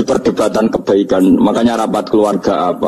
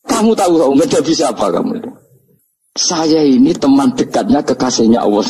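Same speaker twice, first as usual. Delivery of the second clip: retold indignant challenge, pitched up; mock snapping rebuke; 5 semitones higher than usual. Umar confronts the questioning angel Munkar Nakir, mock snapping rebuke. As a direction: pull rank in a raised pitch, half in jest. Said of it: "Orang nyentak wes disentak sih".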